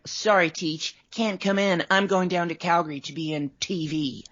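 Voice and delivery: put-on high-pitched, showy teen brag; hammed-up schoolboy excusing himself with glee; playful boasting. Student telling teacher he's off to TV, mock boastful.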